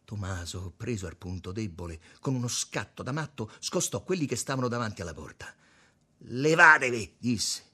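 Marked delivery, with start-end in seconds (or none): 6.2-7.1 forceful shouted command; loud order. A narrator's reading. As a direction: order them out of the way. Tommaso scosta gli altri con rabbia. (ordine gridato)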